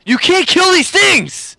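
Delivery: high, loud, drawn-out yell; stretched-out frantic outburst; exasperated shout. Real, not acted. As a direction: holler it in disbelief at unkillable enemies. Overwhelmed by enemies in game.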